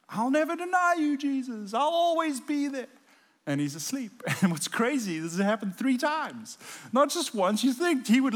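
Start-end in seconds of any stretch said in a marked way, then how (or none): 0.1-2.9 much higher mock-earnest promise; parodying Peter's boast; high, hammed-up vow. Mocking Peter's vow again.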